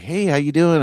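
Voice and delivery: retold eager hello; eyes lighting up. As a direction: imitate a delighted celebrity spotting a fan. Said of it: "her eyes lit up when she saw me".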